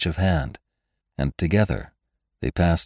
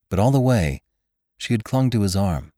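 plain reading voice

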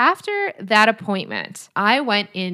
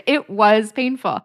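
laughing, slowed; 2 semitones higher, 4 dB louder, slower than usual. Real, not acted.

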